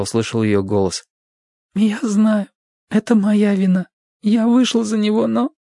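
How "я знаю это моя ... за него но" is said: high, hurt woman's voice, bitter; wounded young woman. Acted. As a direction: voice her bandaged and ashamed. Narrator voices Sadie.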